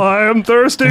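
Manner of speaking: high, loud mock proclamation; heroic shout. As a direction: proclaim it like a cartoon hero. Parody hero declaration.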